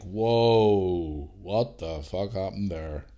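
slow, hushed disbelief; drawn-out stunned whisper; shocked and quiet. Retold or imitated. Waking to a cut shin.

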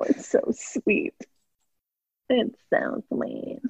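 soft slow whisper. Real, not acted. Whispered fond aside.

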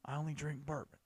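mock the bourbon purist with a slight twang. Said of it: "sound like a redneck".

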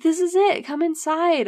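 high, loud eager invitation; acted excitement. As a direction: imitate a son showing off his find. Son proudly shows mom the house.

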